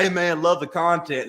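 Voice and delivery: hometown guy's comment. Retold or imitated. Comedian quoting a hometown guy's comment, lightly mimicked.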